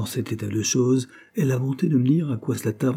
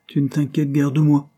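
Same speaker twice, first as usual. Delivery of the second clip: sour reproach, higher and louder; aggrieved, pitched-up reproach; 6 semitones higher, 5 dB louder than usual. A narrator's reading. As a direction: throw it out as a bitter complaint. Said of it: "fit Henry avec aigreur".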